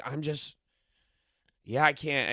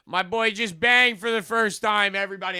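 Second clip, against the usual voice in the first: mock proud announcement; 6 semitones higher than usual. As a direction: imitate a dad crowing to the whole room. Dad announcing to the barber shop.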